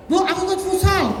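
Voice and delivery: put-on high, bright child's plea; a lightly voiced child calling out; eager child asking. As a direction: play the kid begging mum to join futsal. Child asking mother, acted out.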